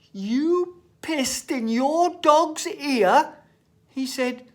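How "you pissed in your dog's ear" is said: slow, loud, high disbelief; appalled teacher. Retold or imitated. Teacher repeating the boy's words, slow appalled disbelief. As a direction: stretch out each word in horror.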